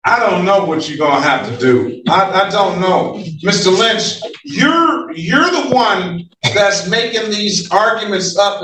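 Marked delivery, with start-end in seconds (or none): none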